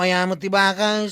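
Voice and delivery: loud insistence. Acted. Pressing his friend about the rats, loudly insisting.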